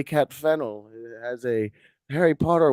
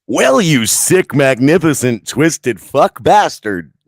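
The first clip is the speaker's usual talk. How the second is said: mock gushing loud; 2 semitones higher, 10 dB louder, slower than usual.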